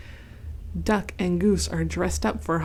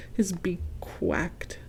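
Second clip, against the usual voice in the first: slow spooky whisper; whispered creepy narration; 8 dB quieter, much slower than usual. A narrator's reading. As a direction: hush it like a ghost story.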